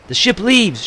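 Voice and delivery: high, loud call with a falling end; shouted. A narrator's reading. Nanko hurrying the women from the cliff, shouting. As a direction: shout the warning down to the villagers. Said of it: "shouted Nanko".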